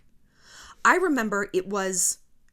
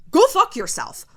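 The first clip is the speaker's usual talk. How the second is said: higher and louder "Go fuck yourself"; loud defiant curse; 7 semitones higher, 7 dB louder than usual. Real, not acted. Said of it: "righteous fire in your soul".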